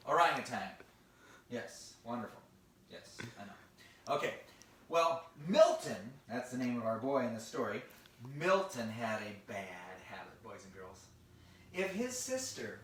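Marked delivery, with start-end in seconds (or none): none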